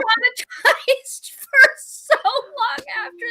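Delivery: half laugh, half cry; laughing distress. Real, not acted. Telling a friend the shark story, laughing and near tears. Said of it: "I was traumatized".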